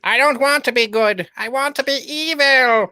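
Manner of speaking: high, hammed-up evil villain voice; cartoon villain. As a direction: declare your wickedness like a cartoon baddie. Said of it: "slips away like Skeletor".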